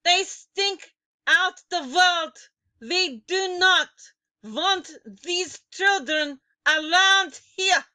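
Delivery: high, loud, slow shout; witch shouting. A narrator's reading. Grand High Witch shouting to the bald witches. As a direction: bellow it at the crowd. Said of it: "she screamed".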